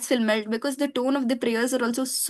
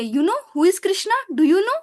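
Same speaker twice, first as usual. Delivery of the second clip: put-on higher, questioning small-child voice; little girl's voice; 6 semitones higher than usual. Little girl asking people about Krishna, little voice. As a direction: lightly imitate a little girl, pitch lifted at the question. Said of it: "she would say in that little voice".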